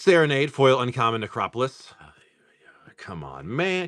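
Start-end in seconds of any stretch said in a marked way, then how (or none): 3.0-3.9 quiet, low come on man; quiet begging for a good card; muttered plea. Real, not acted. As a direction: mutter it under your breath at the pack. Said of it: "pleading with the pack".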